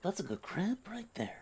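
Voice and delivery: soft unvoiced whisper. Admiring the crab in a whisper.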